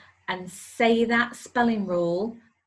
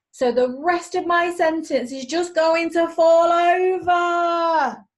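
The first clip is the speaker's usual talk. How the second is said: loud, higher, hammed-up dismay; mock alarm; 5 semitones higher, 10 dB louder than usual. Teacher to pupils, pretending shock at missing capital. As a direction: overplay the shock for the kids.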